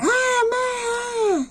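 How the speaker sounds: very high-pitched wailing shriek; mock scream. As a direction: imitate a victim's long, piercing squeal. Imitates women being beaten by cops.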